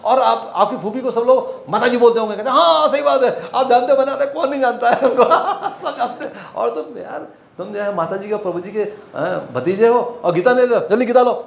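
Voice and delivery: high, eager, tumbling words; eager gushing. Retold or imitated. Retold stranger gushing about his temple elders, excited. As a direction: sell it with excited flattery.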